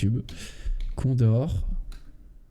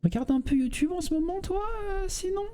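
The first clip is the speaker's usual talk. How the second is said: high-pitched squeaky voice; high silly voice; 5 dB quieter than usual. Pretend. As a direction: ask it in a high cartoon voice. Asks chat questions in a put-on voice.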